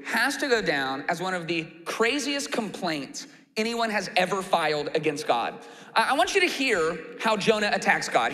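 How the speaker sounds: ordinary talk, nothing marked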